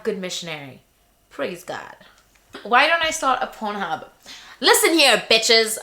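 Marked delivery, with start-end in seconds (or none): 4.6-5.8 grab the room with sass; sassy call-out. Real, not acted.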